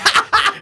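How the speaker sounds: high bright laughing burst; burst of laughter. Real, not acted. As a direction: crack up suddenly. Laughing at the masked guy.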